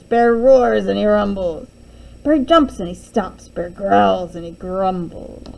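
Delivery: loud, growly telling of an angry bear; roaring storybook narration. A narrator's reading. Narrator telling of bear waking up enraged, loud mock roar. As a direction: boom out the bear's rage.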